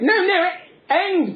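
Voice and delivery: high slow put-on policeman; policeman insisting. Policeman insisting to Punch, slow high exasperation. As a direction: stretch it out, exasperated.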